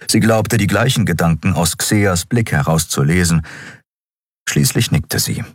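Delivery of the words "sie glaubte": ordinary delivery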